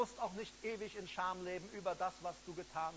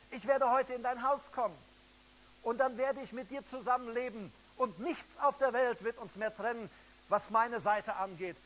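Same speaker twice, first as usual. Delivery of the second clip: louder, rising, fervent sermon cry; preaching at full force; 4 semitones higher, 7 dB louder than usual. Acted. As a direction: drive it home to the listeners online. Jesus's promise proclaimed loudly.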